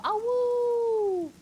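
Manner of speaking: high, sustained wolf howl; playful wolf howl. Pretend. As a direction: howl loud and long like a cartoon wolf. Howls like her little wolf.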